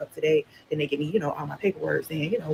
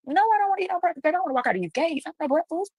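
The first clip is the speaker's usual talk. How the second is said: high-pitched, incredulous refusal; indignant refusal; 13 semitones higher than usual. Retold or imitated. Inmate turns down breakfast on release day, high indignant refusal. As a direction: refuse it as an absurd offer, pitched way up.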